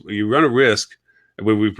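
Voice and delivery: ordinary talk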